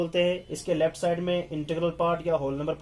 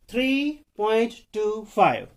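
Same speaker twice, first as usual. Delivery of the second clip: slow, deliberate model reading; teacher slowly dictating each digit; digit-by-digit demonstration; 4 dB louder, slower than usual. Shown as a demonstration. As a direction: spell it out slowly, one word at a time. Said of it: "इस तरह पढ़ना है".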